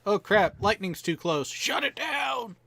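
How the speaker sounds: alarm rising to a high shouted order; mock panicked call. Acts out flight ops weather call.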